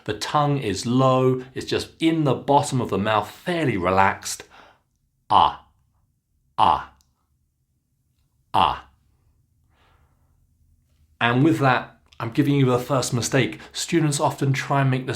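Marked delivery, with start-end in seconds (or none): 5.3-8.9 open-mouthed ah, said low and held; model vowel ah. Shown as a demonstration. Coach shows students the open vowel, open-mouthed ah. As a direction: drop the jaw wide open and say ah. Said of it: "like this".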